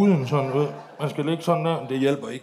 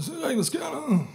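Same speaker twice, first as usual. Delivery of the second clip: put-on much higher, loose drunken mumble; drunk Liam; 10 semitones higher, 3 dB quieter than usual. Mimicking drunk Liam's sauna complaint, much higher. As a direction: imitate a wasted friend in the sauna. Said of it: "det er sådan, Liam lyder, når han er fuld".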